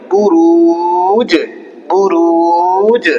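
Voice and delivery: "বুরুজ" with a very long "রু" and bounced final "জ"; Quranic pronunciation demo. Shown as a demonstration. Teacher modelling a double sukun word, drawn-out recitation. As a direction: slowly stretch "বুরুজ", then release the last consonant. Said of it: "দুইটা সাকিন করে পড়বো".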